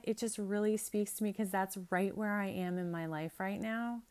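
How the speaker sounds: normal talking voice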